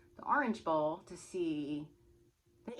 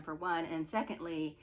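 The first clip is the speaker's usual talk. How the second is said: ordinary delivery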